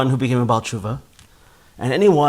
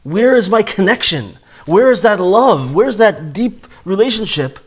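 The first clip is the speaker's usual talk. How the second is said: much higher and louder, aching questions; longing cry; 7 semitones higher, 4 dB louder than usual. Acted. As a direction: cry out for the missing passion. Voicing the soul's longing cry.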